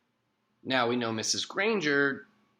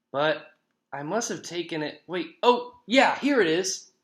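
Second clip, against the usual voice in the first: higher, rising to a louder find; staged discovery; 6 semitones higher, 4 dB louder than usual. A narrator's reading. John pretends to find a pen for Nick, showy excitement. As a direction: act out a search, then a showy find.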